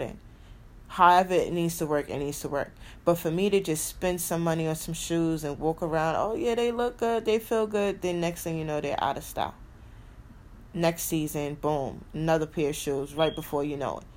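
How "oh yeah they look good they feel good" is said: airy shoe shopper. Retold or imitated. Mocking her old shopping self.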